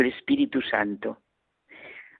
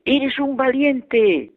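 shouted call; 9 semitones higher, much slower than usual. Retold or imitated. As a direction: shout it proudly to the hills. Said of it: "gritó mi padre".

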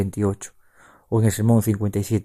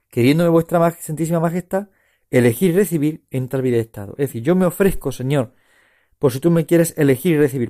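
raised, higher prayerful plea to Christ; fervent prayer; 4 semitones higher, 4 dB louder than usual. Acted. Preacher voicing the oblation to the Lord, fervent prayer. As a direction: pray it out, ardent and lifted.

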